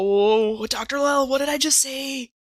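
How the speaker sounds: normal talking voice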